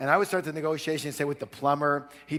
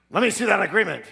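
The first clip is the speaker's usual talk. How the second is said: louder, higher gruff demand; gruff father; 6 semitones higher, 7 dB louder, faster than usual. Retold or imitated. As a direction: snap it like a hard-nosed dad. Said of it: "He goes".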